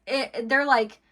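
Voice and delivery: normal talking voice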